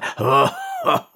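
squealing laugh, very high; high cackling laugh. A narrator's reading. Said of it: "dass er sich die Seiten halten musste".

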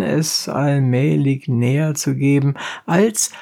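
ordinary delivery

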